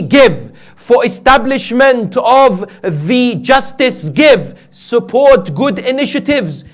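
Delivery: high, loud sermon exhortation; full-force preaching. Real, not acted. Preacher urges congregation to give generously, full-force preaching. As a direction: ring out each command with drawn-out emphasis.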